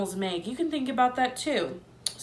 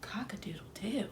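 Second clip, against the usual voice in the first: whispered crow, much softer; 14 dB quieter than usual. A narrator's reading. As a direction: breathe the crow out in a hush. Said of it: "said softly".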